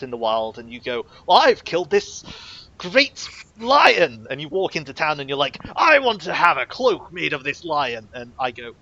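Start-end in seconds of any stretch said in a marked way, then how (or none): 1.3-4.2 high, loud mock-heroic boast; big proud hunter announcement, played up; boastful adventurer. Boastful player acted out in an example. 5.8-8.1 loud, higher mock-heroic demand; over-eager customer, played up; hammed-up adventurer. Acts out player demanding a cloak.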